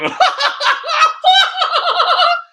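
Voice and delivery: very high, loud mock cackle; Tucker Carlson laugh. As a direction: burst into a long, shrieking imitation laugh. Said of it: "Or like Tucker Carlson".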